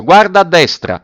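high, loud shouted order; calling up. A narrator's reading. Officer directing the boy aloft, calling out. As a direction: pitch it up to the treetop.

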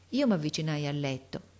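plain reading voice

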